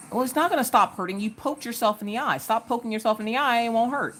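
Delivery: calm the little one down without fuss. Real, not acted.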